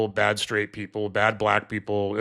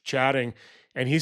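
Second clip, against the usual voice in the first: ordinary delivery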